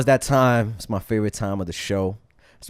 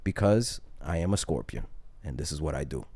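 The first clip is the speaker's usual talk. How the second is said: calm scorpion reply; 8 semitones lower, 9 dB quieter than usual. Retold or imitated. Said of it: "He goes".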